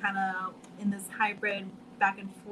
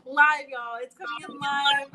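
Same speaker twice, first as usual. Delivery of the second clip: laughing; 4 semitones higher, 7 dB louder than usual. Real, not acted. Guest laughing at her glitch.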